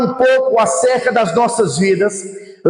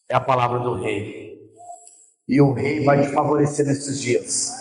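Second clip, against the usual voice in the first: low, measured preacher's blessing; deep-set, solemn promise to the congregation; solemn pronouncement; 6 semitones lower than usual. Real, not acted. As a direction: pronounce a blessing in a lowered pitch.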